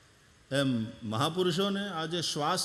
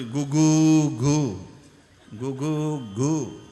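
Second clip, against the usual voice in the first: slow cooing gu gu like a dove; 2 semitones lower, 6 dB louder, much slower than usual. Shown as a demonstration. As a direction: coo like a dove sitting on a rafter. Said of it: "ઘૂઘવાટા કરતો હતો".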